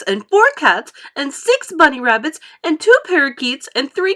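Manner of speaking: loud, high whining tantrum. A narrator's reading. Spoilt girl throws a tantrum at Mummy, loud and whiny. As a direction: stamp your foot and whine.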